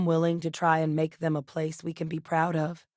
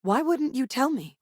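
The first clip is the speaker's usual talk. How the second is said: high-pitched, indignant question; hurt confrontation; 8 semitones higher than usual. Acted. Confronting someone who kept a secret, indignant. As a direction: demand an explanation, pitch jumping up.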